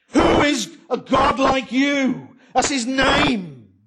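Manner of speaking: slow, high, loud declaration; slow shouted proclamation; ringing proclamation. Real, not acted. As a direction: proclaim the name's meaning. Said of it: "preaching emphasis".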